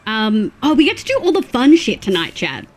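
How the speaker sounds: normal talking voice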